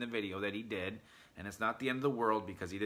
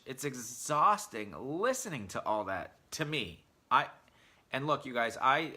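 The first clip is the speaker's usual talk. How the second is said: normal talking voice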